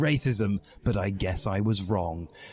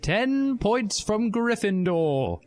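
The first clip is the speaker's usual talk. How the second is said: much higher, slow prim teacher voice; McGonagall voice; 12 semitones higher, much slower than usual. A narrator's reading. McGonagall punishing Harry's protest, slow high prim voice. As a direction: deliver the penalty slowly in a high stern voice.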